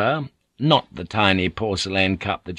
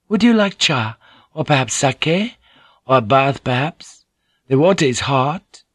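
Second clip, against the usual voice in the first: higher, louder gentle lady; Mariko's voice; 5 semitones higher, 3 dB louder than usual. Acted. Mariko greets Blackthorn, higher courteous lady's voice.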